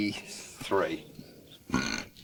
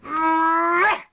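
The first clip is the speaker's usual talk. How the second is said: imitated wince. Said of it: "And he went".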